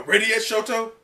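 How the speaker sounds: lightly voiced sidekick; sidekick asking. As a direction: ask it like a nervous sidekick. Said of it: "they go".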